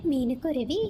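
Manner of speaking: usual speaking voice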